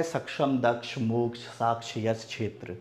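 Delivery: सक्षम and क्षेत्र said with everyday छ-like sounds; common pronunciation. Shown as a demonstration. Teacher lists what passes in the market, common pronunciation. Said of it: "market में यही चलता है".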